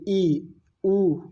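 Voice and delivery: ई and ऊ said with the mouth barely open; teacher demonstrating close vowels; close vowels demo. Shown as a demonstration. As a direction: keep the lips tight and sound ई, ऊ. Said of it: "बड़ी बोलेंगे".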